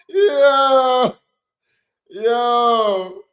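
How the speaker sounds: loud, high yell of surprise; loud hyped yell; shouted yo. Real, not acted. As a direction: shout it out in shock. Hype reaction to chat.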